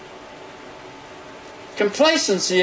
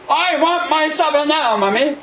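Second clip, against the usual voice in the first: put-on a higher, demanding little boy voice; whiny child; 9 semitones higher than usual. Child demanding supper from his mother, a higher whiny plea. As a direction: play a child wanting supper now.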